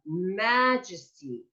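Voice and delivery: majesty slow, humming the M; drawn-out M. Shown as a demonstration. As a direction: linger on the M of majesty. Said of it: "M's, N's".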